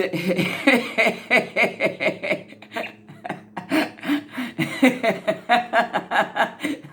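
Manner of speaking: a long burst of real, high laughter; laughing at her own nerves on camera, loud helpless laughter. Real, not acted. Nervous woman on camera can't stop laughing, loud real laugh.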